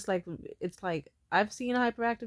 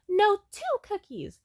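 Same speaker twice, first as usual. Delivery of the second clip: put-on high, slow, insistent child voice; bargaining little boy; 12 semitones higher, 3 dB louder, slower than usual. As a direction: imitate a kid holding out for more. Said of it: "and he says".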